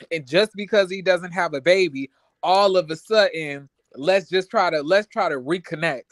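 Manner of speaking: ordinary talk, nothing marked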